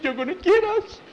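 high loud squeal of fear; hammed-up falsetto panic; panicked sidekick. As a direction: squeak it out in comic dread. Frightened Skeeter about to be found.

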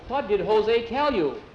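insistent question. A narrator's reading. Manuel presses Jackie about Jose, an insistent high-pitched question.

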